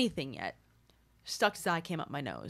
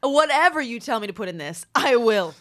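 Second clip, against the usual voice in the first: eager shout; 5 semitones higher, 10 dB louder than usual. Retold or imitated. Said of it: "And I'll be like".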